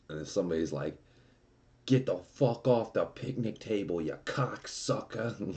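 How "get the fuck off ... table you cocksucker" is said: higher-pitched irate New York park cop; New York accent. Retold or imitated. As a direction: put on a New York accent, drawing out the final insult. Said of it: "like, in this New York accent".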